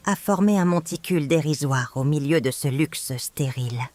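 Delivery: ordinary delivery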